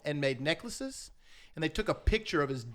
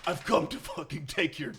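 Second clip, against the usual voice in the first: menacing metal character. Pretend. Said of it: "really that character and be like".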